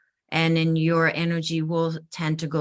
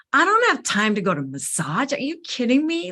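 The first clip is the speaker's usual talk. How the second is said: mock-incredulous, rising much higher at the end; exasperated retort; 5 semitones higher than usual. Burnt-out person refusing a massage suggestion, exasperated. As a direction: push back in acted disbelief, pitch climbing.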